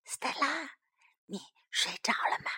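hushed whisper, very little voice. Acted. Sam asks if Stella is asleep, whispering. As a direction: whisper it softly, as at bedtime. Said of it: "山姆悄悄地说".

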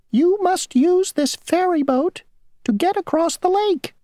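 very high, tiny, fussy insect voice; a small stickler of an insect stating the rule; mosquito voice. Acted. Mosquito ferryman laying down the rule to Grasshopper, tiny high voice.

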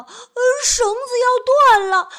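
high, loud, frantic wailing; panicked shouting. Acted. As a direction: babble in terror as the rope gives. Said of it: "他惊慌地喊道".

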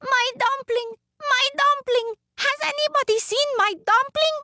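very high, frantic calling; high-pitched little woman on the run; crying out. A narrator's reading. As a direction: call for it in a piping little voice. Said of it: "she kept running down the road crying".

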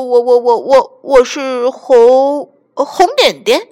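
loud, slow, scared stutter; the chameleon stammers before the lion; stammering. A narrator's reading. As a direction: stumble over every word in fright. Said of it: "变色龙结结巴巴地说".

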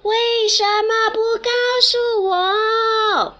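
high, loud upset call. Acted. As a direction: yell the complaint at the mountain. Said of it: "小兔子喊".

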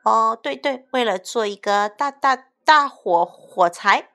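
loud, high, stumbling repeats; incoherent stammer. Acted. Berio covering the fireworks secret, stammering in a panic. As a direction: trip over every word to hide the secret. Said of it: "贝里奥语无伦次的小声说".

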